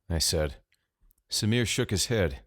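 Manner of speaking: ordinary delivery, nothing marked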